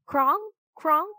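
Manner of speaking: high, ringing raven call; bell-like bird call. A narrator's reading. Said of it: "Rock made soft bell-like sounds".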